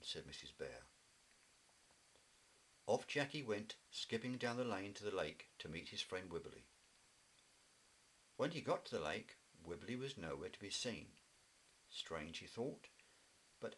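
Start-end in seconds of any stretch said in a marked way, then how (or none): none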